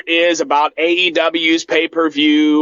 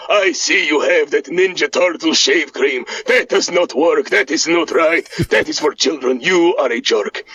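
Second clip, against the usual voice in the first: Boris voice; 2 semitones lower than usual. Acted. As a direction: play the boastful Eastern European cousin. Boris teases about shave cream.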